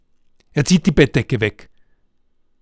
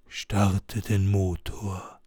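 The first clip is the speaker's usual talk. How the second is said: low, very slow, softer ghostly command; a ghost speaking from the empty back seat; 4 semitones lower, 6 dB quieter, much slower than usual. Acted. Ghost Jonas tells Lars to start the car: low slow soft ghostly voice. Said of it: "Und die Stimme sagt".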